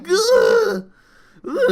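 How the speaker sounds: high, loud retold cry of pain. Cries out to Tiff as her eye burns. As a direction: yelp like your eye is on fire. Retelling eye burning, crying out.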